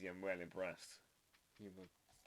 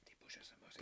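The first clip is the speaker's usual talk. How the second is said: whisper; 13 dB quieter, faster than usual. Shown as a demonstration. Repeating phrase learnt from chat.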